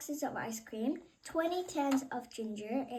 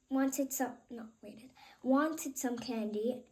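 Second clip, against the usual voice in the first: usual speaking voice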